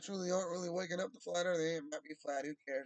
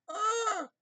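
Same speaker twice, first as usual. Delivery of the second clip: mock shriek; 5 dB louder than usual. Mock exclamation.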